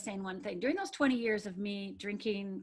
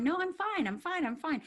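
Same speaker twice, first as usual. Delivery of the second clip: higher-pitched mock reassurance; insisting she's okay; 8 semitones higher than usual. Girl who had to be okay, insisting. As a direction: brush it off in a put-on, lifted voice. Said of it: "the girl who, you know, had to be okay".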